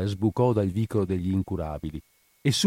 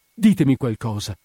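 louder, urgent plea; desperate plea; 3 semitones higher, 5 dB louder than usual. A narrator's reading. Spina gripping the widow's arm, desperate plea. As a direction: beg urgently for an answer. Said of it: "afferrandole il braccio e serrandoglielo convulsamente".

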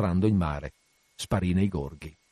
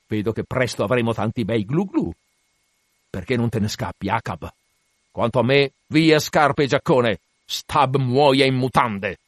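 animated sailor jeering at the whale, bursting louder; defiant Stab; 3 semitones higher, 5 dB louder than usual. Acted. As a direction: throw out mocking, theatrical defiance at death. Voices Stab defying the grinning whale.

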